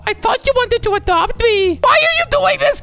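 very high, hammed-up wail; playful cry of betrayal; mock pleading. Playing the abandoned one.

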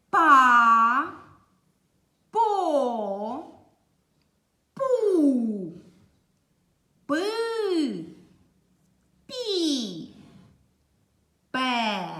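Па, по, пу each stretched on a long gliding vowel; syllable reading drill. Shown as a demonstration. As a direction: sound out each syllable slowly, almost chanted. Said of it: "Другий стовпчик".

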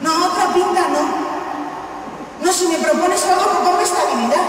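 high, frantic refusal; panicked pleading. Retold or imitated. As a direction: beg them to stop the pints. Drunk flashback pleading.

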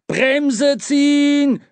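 high, loud, long-drawn yell; urgent slow-stretched roar; bellowed command. A narrator's reading. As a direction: holler the order, stretching the last word. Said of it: "brüllte Qualmer".

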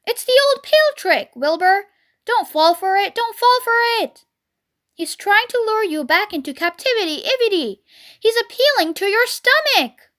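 high, urgent goose warning; alarmed goose. A narrator's reading. Goose warning Wilbur against the pail trick, alarmed goose. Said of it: "said the goose".

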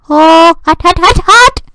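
high yelps of hot; high yelping hot hot hot. Real, not acted. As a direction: squeal it like you touched a stove. Burnt by falling Tetris block.

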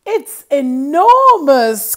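put-on a boy thrilled at the giant turnip; Jack crying out. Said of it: "cried Jack".

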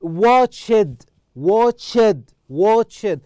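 "Watched" said loudly as two syllables, watch-ed; teacher drilling "Watched" with the -ed fully sounded; pronunciation model. Shown as a demonstration. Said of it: "How to pronounce well".

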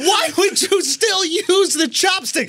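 high-pitched, drawn-out comic disbelief; hammed-up bafflement, stretching the words slowly; mock exasperated rant. Mock rant at chopstick users.

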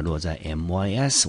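ordinary talk

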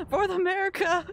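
high, loud hammed-up patriotic shout; mock rallying cry. Streamer jokingly dedicates it to Biden, hammed-up shout. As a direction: belt it like a joke battle cry.

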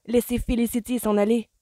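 loud, high exasperated order; exploding. A narrator's reading. Colin tells mother to let Felicity go, exploding. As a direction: order it, patience gone. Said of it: "explosa Colin".

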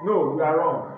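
loud, slow classroom dictation voice; spelling dictation. Acted. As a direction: call each word and letter out to the class. Teacher dictating spelling words.

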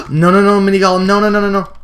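high, loud, panicked no-no-no; panicked scream. Real, not acted. Gamer cornered by a mini golem, panicked scream. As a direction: shriek it in real panic.

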